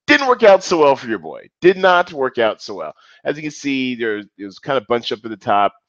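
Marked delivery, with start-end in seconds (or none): 0.1-2.9 higher and louder, mock triumphant; hammed-up catchphrase. Real, not acted. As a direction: belt it like a sportscaster joke. Repeats catchphrase with gusto.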